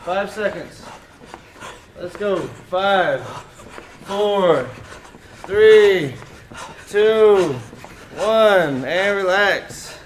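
loud, high, pushing the class; shouting countdown. Real, not acted. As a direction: yell the count to drive them on.

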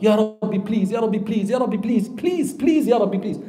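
high, repeated begging, run together; panicked pleading. Retold or imitated. Repentant sinner begging Allah, panicked. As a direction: imitate desperate pleading, pitch lifted. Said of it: "you're in panic, and that you say".

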